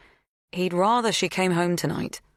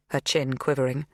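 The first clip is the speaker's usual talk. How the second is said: usual speaking voice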